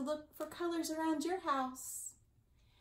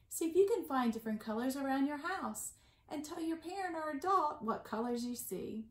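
ordinary delivery

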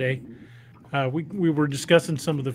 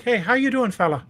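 higher-pitched, lilting Irish greeting; soft Irish accent; 6 semitones higher than usual. Acted. Grandfather-based character greeting a fella, soft Irish accent. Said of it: "most times he'd be like".

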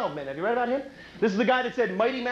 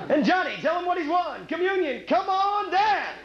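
game show announcer; 7 semitones higher than usual. Acted.

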